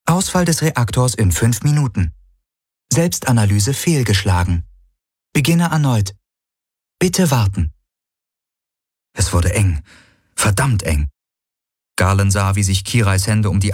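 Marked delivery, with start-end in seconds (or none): 0.0-7.7 lightly voiced computer alert, pitched up; higher system announcement, a hint of a machine; ship computer. A narrator's reading. Ship's system reports a reactor failure, computer voice.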